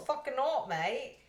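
mock Australian Siri voice. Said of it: "he's Australian".

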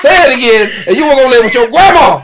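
loud, fast parental rant; hammed-up scolding father; mock angry dad threat. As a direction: threaten the kid, rushing. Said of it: "and I'm like".

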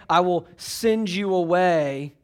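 "I will send you away" spelled out slowly; slow, deliberate. Shown as a demonstration.

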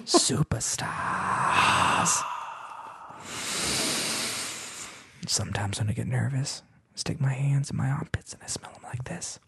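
soft unvoiced whisper. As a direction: hush it like a secret. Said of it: "Why are you whispering?".

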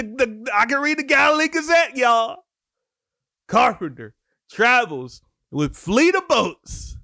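excited preacher hammering the point home; fired-up preaching. Real, not acted. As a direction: preach it up into a high, loud exclamation. Preaches with rising force.

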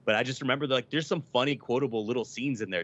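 ordinary talk, nothing marked

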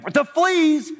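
very high, drawn-out "fleas"; incredulous outburst. Acted. Corrie balks at Betsy's thanks, high and incredulous. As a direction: stretch "fleas" slowly in high-pitched disbelief.

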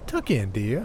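sing-song voice. A narrator's reading. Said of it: "she said in a sing-song voice".

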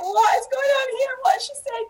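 high-pitched mock bewilderment; imitated bafflement. Guest imitating the confused host's expression, high mock bewilderment. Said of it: "you should see his face".

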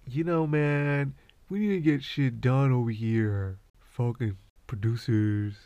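mock bossy boss. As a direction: scold the crew like a fake boss. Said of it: "I'm just playing producers".

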